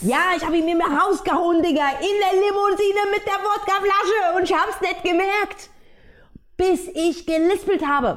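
high, animated telling; excited. Real, not acted. Telling her friend how she lost the tooth, high and animated. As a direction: hit it high with amused disbelief. Laughing disbelief at own story.

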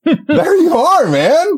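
excited greeting. Real, not acted. Host greets returning co-host.

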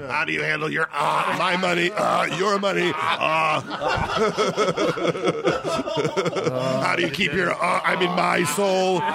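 mock shouting.

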